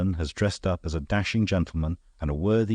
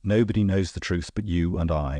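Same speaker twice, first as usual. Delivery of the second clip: plain reading voice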